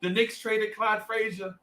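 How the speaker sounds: high, mock-tearful retelling; about to cry. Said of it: "be about to cry".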